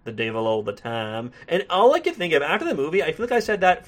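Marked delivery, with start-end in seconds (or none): 0.0-1.3 put-on Southern accent. Reviewer quoting the narrator over a scene, Southern accent. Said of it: "in his Southern accent, he says".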